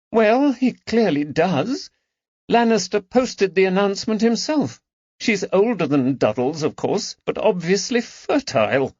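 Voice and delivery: high, bitter aristocratic voice. Acted. Lady Caroline complaining to her friends, high and bitter. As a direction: spit out the slight with resentment. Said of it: "tone was bitter".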